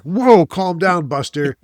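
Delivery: drawn-out mock ref rebuke; mock ref scolding. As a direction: referee telling a player to calm down, slow and loud. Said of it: "and it's like".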